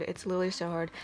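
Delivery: ordinary delivery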